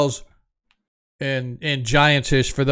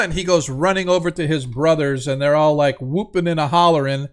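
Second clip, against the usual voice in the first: usual speaking voice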